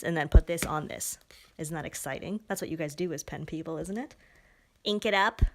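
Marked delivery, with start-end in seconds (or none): 1.6-3.8 whispered, softer, mock-excited; whispered aside. Real, not acted. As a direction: whisper it like a secret thrill.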